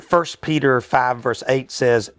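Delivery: ordinary talk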